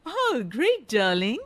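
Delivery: a hint of a posh lady; airy reply to a stranger; snooty auntie.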